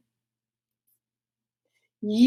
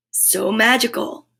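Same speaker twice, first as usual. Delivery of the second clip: ordinary delivery, nothing marked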